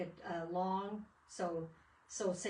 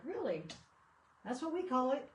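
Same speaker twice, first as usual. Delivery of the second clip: surprised reply; 6 semitones higher, 3 dB louder than usual. Retold or imitated.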